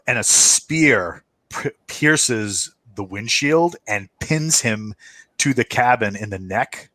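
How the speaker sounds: usual speaking voice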